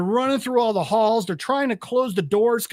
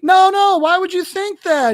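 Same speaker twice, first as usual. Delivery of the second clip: much higher, louder, nervously denying; panicked reassurance; 11 semitones higher, 7 dB louder than usual. Retold or imitated. Principal to frightened little girl, high nervous denial. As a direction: principal failing to hide his panic.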